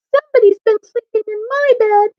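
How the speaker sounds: put-on very high, tiny Baby Bear; wee little voice. A narrator's reading. Baby Bear finds his bed slept in, wee little voice. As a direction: pipe up as a little bear cub. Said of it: "in his wee little voice".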